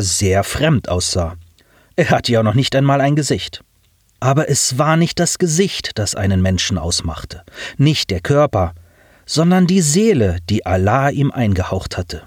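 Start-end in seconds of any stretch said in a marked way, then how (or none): none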